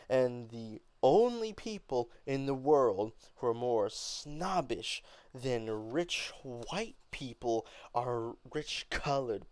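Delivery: complaining hotly about rich people; angry and upset. Acted. Beneatha complaining to Mama, angry and upset. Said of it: "start off with an angry and upset tone".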